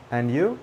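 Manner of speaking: "And you?" rising sharply on "you"; questioning intonation. Shown as a demonstration. Teacher showing learners the intonation, "And you?" rising on "you". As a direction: lift "you" at the end of "And you?". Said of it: "the intonation is important".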